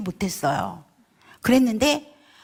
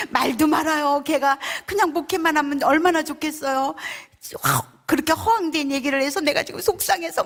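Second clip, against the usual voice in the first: higher-pitched exasperated complaint; retold upset venting; 6 semitones higher than usual. Sister complaining to the church elder, upset venting. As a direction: imitate a sister venting, mildly worked up. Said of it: "지금 막 화가 나 있잖아요".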